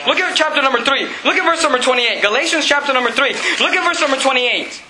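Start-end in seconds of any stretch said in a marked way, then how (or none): none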